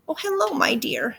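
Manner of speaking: put-on soft, high little-old-lady voice; quiet, high-pitched and coaxing; disguised old lady. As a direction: greet sweetly as a frail old lady. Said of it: "disguised herself as a little old lady".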